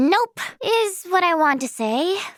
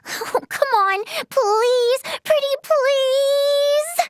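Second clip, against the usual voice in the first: high, drawn-out begging; wheedling plea; 9 semitones higher, much slower than usual. Acted. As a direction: stretch the pleases into a cutesy whine. Begging Ray to make coffee.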